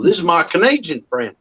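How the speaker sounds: a mild imitated Southern drawl, "Canadian" stretched. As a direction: lightly put on a Texan drawl. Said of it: "heavy Southern drawl".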